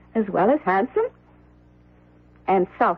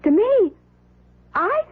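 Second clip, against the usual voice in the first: indignant surprise; 9 semitones higher than usual. Acted. Indignant retort to husband.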